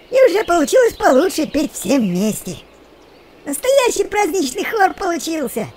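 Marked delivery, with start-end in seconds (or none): none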